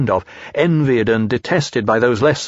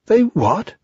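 surprised Pooh; 10 semitones higher than usual. Acted.

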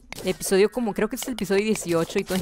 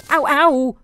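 1 mock ow; 7 semitones higher, 8 dB louder than usual. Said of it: "Ok, eso duele".

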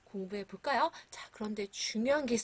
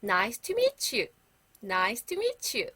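slow, careful "Nice to meet you."; slow model phrase; much slower than usual. Shown as a demonstration. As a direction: spell it out slowly for the class to repeat. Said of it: "큰 소리로 다시 한번 따라서". (천천히 또박또박 "Nice to meet you.")

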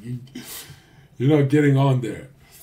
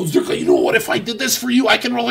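mocking a pushy eleven; 11 semitones higher, 7 dB louder, faster than usual.

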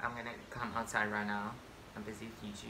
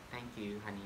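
usual speaking voice

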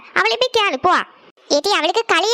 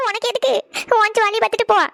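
tearful, higher-pitched retort; crying; 3 semitones higher than usual. Acted. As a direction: push sister away through sobs. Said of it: "எதுக்கு அழுதுட்டு இருக்க". (அழுதுகொண்டே எரிச்சலாக)

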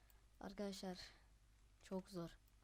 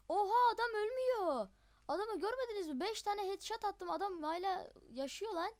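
much higher, loud disbelieving yell; shocked outcry; 10 semitones higher, 8 dB louder than usual. Real, not acted. Player amazed the enemy survives his headshots, disbelieving shout. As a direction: cry out in disbelief.

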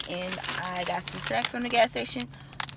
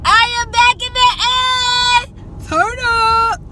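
excited shout; 11 dB louder, slower than usual. Real, not acted. Celebrating arrival, shouting.

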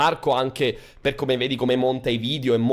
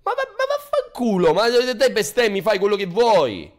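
explosive italian cursing; 9 semitones higher than usual. Acted. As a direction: explode into a stammered swear. Burst of cursing at cohost. (sfuriata esplosiva di imprecazioni)

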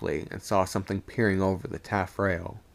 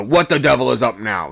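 loud startled call; 3 semitones higher, 9 dB louder than usual. A narrator's reading. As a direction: sing out the question sharply. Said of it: "sung out the second".

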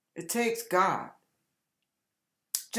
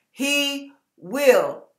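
ordinary delivery

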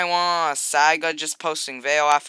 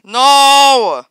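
much higher, loud cry of protest; frustrated yell; 10 semitones higher, 12 dB louder, slower than usual. Real, not acted. Gamer just killed by another player, yelling in frustration. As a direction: scream it as you get killed.

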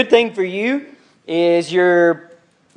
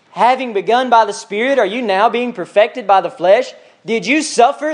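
a little angry; 3 semitones higher than usual. Acted. Paul challenging the Galatians, indignant and upset. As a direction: press each question like a scolding parent. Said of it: "Think he's a little angry?".

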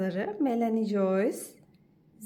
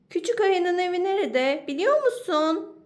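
higher, louder fox calling up; 8 semitones higher, 6 dB louder than usual. Acted. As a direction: call up to the bird. Fox calls to the bird.

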